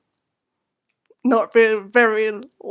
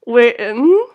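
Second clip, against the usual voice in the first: usual speaking voice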